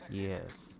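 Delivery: usual speaking voice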